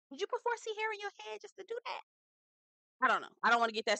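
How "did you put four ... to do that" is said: high, quick, quiet squeak; high-pitched mock questioning; mock nosy question. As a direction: ask it in a high, rapid little voice. Mock question to braid wearers.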